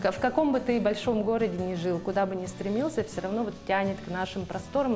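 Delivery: ordinary delivery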